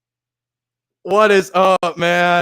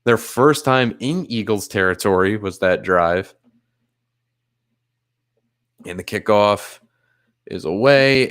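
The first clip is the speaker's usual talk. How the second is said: low-pitched, measured football commentary; live play-by-play; 8 semitones lower than usual. Real, not acted. Streamer calling football for viewers, low play-by-play. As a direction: call the game evenly, low in the voice.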